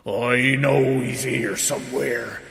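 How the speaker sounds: slow giant voice; crying-out giant. A narrator's reading. Giant hunting for Jack, slow drawn-out cry. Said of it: "cried the giant".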